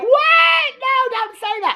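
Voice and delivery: mock outraged protest. Viewer pleading with the boy on screen, high mock-horrified cry. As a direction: squeal it in hammed-up dismay.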